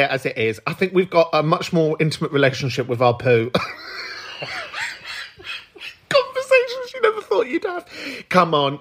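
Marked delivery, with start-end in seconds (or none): 3.5-5.2 high silent wheeze of laughter; breathy giggle; airy laugh. Real, not acted. Laughing at poo remark.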